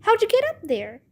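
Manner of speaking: high-pitched puzzled question; surprised. A narrator's reading. Babysitter to girls stuck overhead, surprised. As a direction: ask with wonder, pitch lifted. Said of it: "in a surprised voice".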